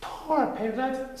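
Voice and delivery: high, slow sympathetic sigh; sighing Christopher Robin. A narrator's reading. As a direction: sigh kindly for his friend. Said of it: "sighed Christopher Robin".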